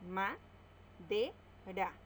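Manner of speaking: slow, each syllable of ma-de-ra split apart. Shown as a demonstration. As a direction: spell out ma-de-ra in slow separate beats. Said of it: "la silabeamos". (separando ma-de-ra sílaba por sílaba, despacio)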